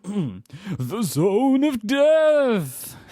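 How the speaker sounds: very slow, high, eerie drawn-out intoning; creepy voice. Acted. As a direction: stretch it out like a spooky movie trailer. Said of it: "say that in a more creepy voice".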